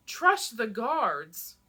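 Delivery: loud, drawn-out disbelieving cry; scream. A narrator's reading. Zélie screaming at Enon in disbelief, loud and slow. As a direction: hurl the words back at him, very slowly. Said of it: "I scream so loud".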